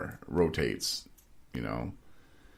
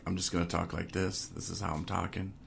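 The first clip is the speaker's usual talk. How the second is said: soft, even, nice-and-cool talk; imitating laid-back mic check; 6 dB quieter than usual. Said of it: "in the sound check, though, he was like".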